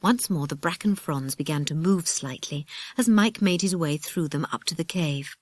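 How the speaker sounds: ordinary delivery, nothing marked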